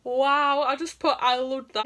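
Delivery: loud, high wow of approval; delighted reaction. Real, not acted. Reacting to a rhyming chat-up line, loudly delighted. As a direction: burst out loudly, impressed by the line.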